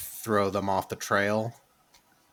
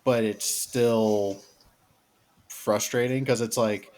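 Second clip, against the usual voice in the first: normal talking voice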